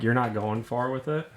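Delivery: ordinary talk, nothing marked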